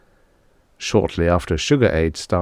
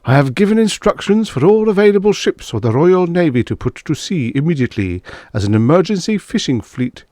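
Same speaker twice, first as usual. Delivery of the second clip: much higher, louder ringing statesman's voice; Prime Minister's broadcast; 10 semitones higher, 4 dB louder than usual. Acted. Prime Minister ordering the Royal Navy out, lofty raised broadcast. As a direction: announce the order like a stern Prime Minister on air. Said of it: "The British Prime Minister spoke on the B B C".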